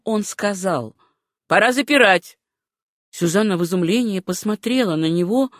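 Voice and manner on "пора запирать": mildly curt official; curt, impassive. A narrator's reading. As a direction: announce it with a touch of sharpness, impersonally. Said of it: "Резким, хотя и бесстрастным голосом".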